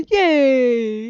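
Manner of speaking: loud, happy squeal; real excitement; delighted cheer. Real, not acted. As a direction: cheer like the dog just made your day. Pet dog likes bone.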